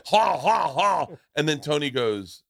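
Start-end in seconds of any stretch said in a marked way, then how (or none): 0.1-1.1 high, hammed-up "ha, ha, ha"; mock laugh. Contestant laughing at his own crude answer, mock laugh. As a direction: punch out three spaced fake laughs.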